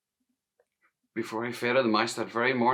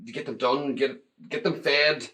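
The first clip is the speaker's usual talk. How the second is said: plain reading voice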